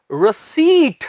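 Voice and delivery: रसीट with a clear final hard ट; correct pronunciation. Shown as a demonstration. Teacher showing students the right way to say रसीट. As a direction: say रसीट, stress on सी, ending in a crisp ट. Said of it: "इसका सही उच्चारण है".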